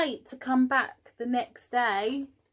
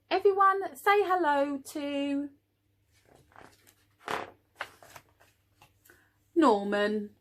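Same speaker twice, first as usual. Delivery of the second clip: queen introducing; 6 semitones higher, 5 dB louder than usual. Acted.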